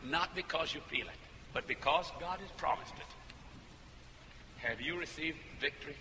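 very soft, slow pastoral assurance; gentle coaxing. Retold or imitated. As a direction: coax her softly toward belief. Pastor gently coaxing a hesitant woman.

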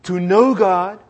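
slow, firm, every word weighted; slow emphatic motto. Shown as a demonstration. Preacher teaching the congregation a motto, slow and firm. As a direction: spell it out slowly to be memorized. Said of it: "say that with me".

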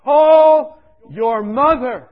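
slow, loud, high booming command; voice from above. Retold or imitated. A mystic voice ordering him to call his mother, slow and booming. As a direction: call out like a voice from heaven. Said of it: "this voice came to me".

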